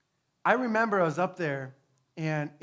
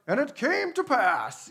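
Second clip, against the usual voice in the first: high, slow, solemn King James intoning; hammed-up old Bible voice; mock scripture reading; 8 semitones higher, 4 dB louder, slower than usual. Shown as a demonstration. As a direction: read it like a dusty Bible verse. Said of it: "filler lines that we have".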